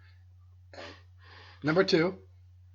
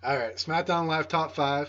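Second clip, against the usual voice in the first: announcer voice; 5 semitones higher, 6 dB louder than usual. Acted. Introduces the show segment.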